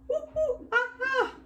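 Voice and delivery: very high monkey hoots; monkey call. Pretend. Teacher showing children the sound after each line, monkey call. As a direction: hoot and screech like a monkey. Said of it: "I wanna do a monkey voice".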